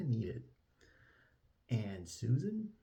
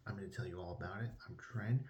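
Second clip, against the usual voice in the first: usual speaking voice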